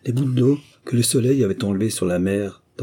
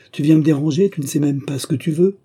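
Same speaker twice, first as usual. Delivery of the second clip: higher and louder, an annoyed reproach; irritated scolding; 6 semitones higher, 4 dB louder than usual. A narrator's reading. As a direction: scold the intruder sharply. Lapluie scolds the intruding miller. (madame Lapluie gronde le meunier, d'une voix plus haute et forte)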